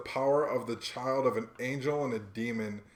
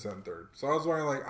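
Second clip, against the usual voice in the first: usual speaking voice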